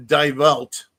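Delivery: Die Welt with Die like English "dye"; English-style pronunciation. Shown as a demonstration. Host trying a German newspaper's name, anglicised pronunciation. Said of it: "don't have the best German accent".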